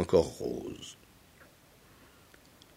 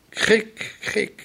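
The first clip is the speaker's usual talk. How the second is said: high heron laugh; 12 semitones higher than usual. Acted. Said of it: "et il rit".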